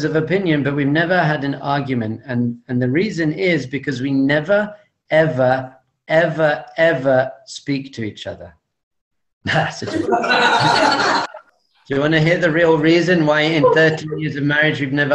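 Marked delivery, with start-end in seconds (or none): 4.0-8.5 each ever hit separately; stretched never ever ever. Shown as a demonstration. Punchline on never arguing. 10.0-11.4 short breathy laugh; own laugh. Real, not acted. Laughing at his own joke about his wife.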